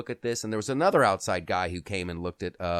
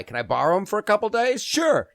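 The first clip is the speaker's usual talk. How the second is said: retold phone chat; 9 semitones higher, 6 dB louder than usual. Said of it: "And they're like".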